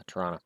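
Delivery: "Toronto" clipped to "Tronna", softly; "Toronto" squeezed to two syllables, "Tronna"; dad's Toronto. Shown as a demonstration. Showing how his Newfoundland dad said it, "Tronna". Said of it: "if you hear me say it like that".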